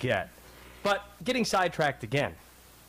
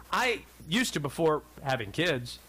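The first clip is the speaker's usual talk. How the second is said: ordinary talk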